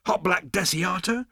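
high astonished question. Acted. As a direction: ask in disbelief. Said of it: "said Zaphod in astonishment".

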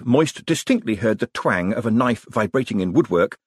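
ordinary delivery, nothing marked

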